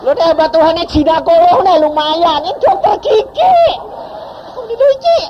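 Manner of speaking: high, loud, laughing scoff; husband laughing in disbelief that a dentist advised this. Retold or imitated. Husband mocks dentist advice, laughing.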